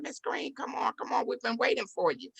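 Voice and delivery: lightly voiced greeter, quick to usher someone in; gentleman calling her over.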